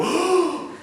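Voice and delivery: high, audible mock-shocked gasp; big theatrical intake of breath; awed gasp. Congregation sees the rabbi hold up a knife, gasping.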